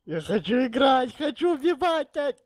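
high, loud impatient yell; raging gamer. Real, not acted. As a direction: shout it like a tilted gamer. Impatient gamer yelling while waiting.

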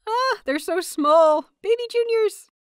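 cooing over the little juniors. Acted. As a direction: gush over the tiny kids.

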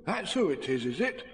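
lightly voiced, musing bear; placid Pooh. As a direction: take the news in placidly. Pooh calmly takes in the news.

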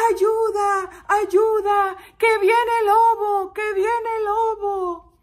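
high, loud drawn-out alarm call; shepherd shouting. Acted. Shepherd boy crying wolf to the village, shouting. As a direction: cry out for help across the fields. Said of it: "gritó el pastorcito".

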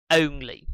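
"Only" with the ô sound, not the short on; correct pronunciation. Shown as a demonstration. A teacher models the right vowel for students. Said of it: "Âm ơ u chứ không phải là chữ on".

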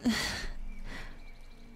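a quiet, breathless little laugh to yourself; soft whispered laugh. Acted. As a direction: chuckle under your breath at the dark thought. Laughing at own dark hint.

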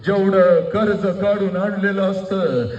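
slow declaiming with stretched phrase endings; emotive, drawn-out proclamation; impassioned oratory. Acted. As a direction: draw each phrase out to the crowd. Climax of the emotive speech.

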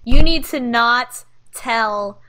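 angry. Acted. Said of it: "looks angrily at Vlad and says".

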